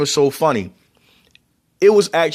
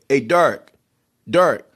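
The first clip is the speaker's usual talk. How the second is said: Baltimore pronunciation of Derek; 2 semitones higher than usual. Retold or imitated. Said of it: "I didn't say Derek".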